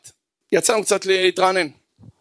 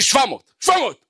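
exaggerated anger; 8 semitones higher than usual. Angry student rates his anger.

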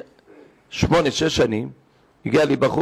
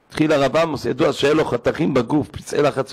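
usual speaking voice

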